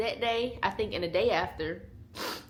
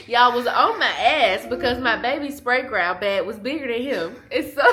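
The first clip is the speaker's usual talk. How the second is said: ordinary delivery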